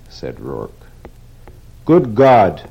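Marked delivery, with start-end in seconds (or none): 1.9-2.6 louder, higher outburst; sudden cry. Acted. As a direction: blurt it out in shock. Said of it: "Weidler cried suddenly".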